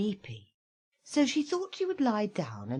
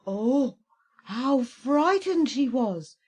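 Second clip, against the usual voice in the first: dramatic; 6 semitones higher, 6 dB louder, slower than usual. A narrator's reading. Narrator dramatizes fright.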